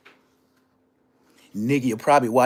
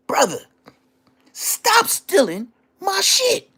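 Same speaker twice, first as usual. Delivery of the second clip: mock voice; slower than usual. Mocking voice of the brothers.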